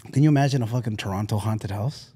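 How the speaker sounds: usual speaking voice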